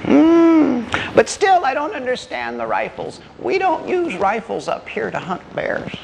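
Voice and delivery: put-on high, doubtful old-timer; old man. Old man doubts the hunters' rifles, high old-timer voice. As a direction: puzzle it out in a high granddad voice.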